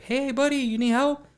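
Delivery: high, lilting hail to a stranger; calling out. Retold or imitated. Trucker to a staring stranger, friendly calling out.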